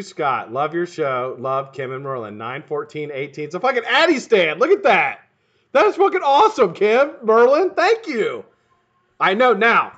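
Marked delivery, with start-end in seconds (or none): 3.4-9.9 loud, high, delighted outburst; real excited thanks; thrilled gratitude. Real, not acted. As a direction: exclaim over an amazing gift. Thrilled by the gift.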